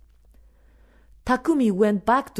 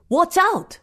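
sharp warning shout; 9 semitones higher, 4 dB louder than usual. A narrator's reading. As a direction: yell a warning. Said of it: "The shout from another worker".

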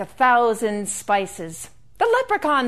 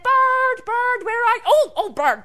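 calling out loudly; 10 semitones higher, 4 dB louder than usual. Acted. Leprechaun calling for the bird.

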